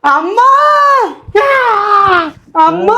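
high, drawn-out rising wail for Mum; babyish call for mother. Acted. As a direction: call Mum like a child wanting milk. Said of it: "বান্দির পুতে এইরকম ভাবে ডাকে, মনে হয় দুধ খাইবো".

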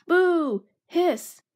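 high, loud jeering; shouted boo. Acted. Gang booing at Basil's name, loud jeers. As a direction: jeer like a rowdy gang. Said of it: "shouted the gang members".

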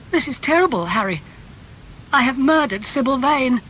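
put-on young man crying out in guilt to his friend; anguished. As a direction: wail in guilty horror. Dorian's anguish at the death.

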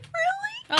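high shouted really with laughter; half laughing, half crying; tearful delighted disbelief. Retold or imitated. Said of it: "I'm like".

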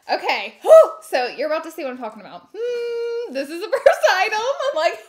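normal talking voice

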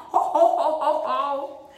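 loud booming ho ho laugh; gorilla laugh. Shown as a demonstration. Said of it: "Can you laugh like a gorilla?".

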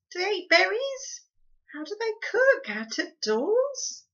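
high, fretful questions. Acted. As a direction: fret anxiously. Homily fretting.

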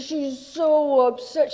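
loud, slow, high mock fuss; hammy drunk diva. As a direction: coo over her loudly like a tipsy star. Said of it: "She says".

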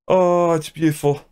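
a high, thrilled gasp of praise; delighted awe. Real, not acted.

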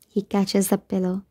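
plain reading voice

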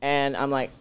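ordinary delivery

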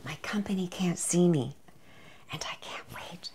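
furtive, hushed confession; secretive whisper. Pretend. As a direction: whisper it so the guests won't hear. Sneaking a taste unseen by guests.

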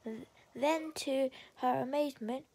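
plain reading voice

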